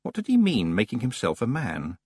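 ordinary delivery, nothing marked

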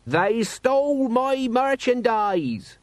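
high, slow indignant shout; shouting merchant. A narrator's reading. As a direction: shout your grievance across the square. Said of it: "shouted a speaker opposite him".

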